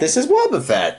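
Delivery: excited high-pitched guess; pictionary guessing. Real, not acted. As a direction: blurt the answer with delight. Drawing guess game.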